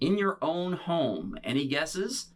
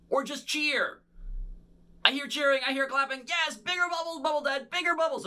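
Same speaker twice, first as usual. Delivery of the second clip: excited; 10 semitones higher than usual. Pretend. Kids' show host hyping audience.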